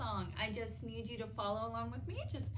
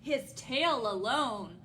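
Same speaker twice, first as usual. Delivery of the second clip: bold, full storybook narration; reading to children; 7 dB louder, slower than usual. A narrator's reading. Storytime host introduces the neighbor's dragon to children, bold narration. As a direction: read it out big for the dragon. Reads picture book to children.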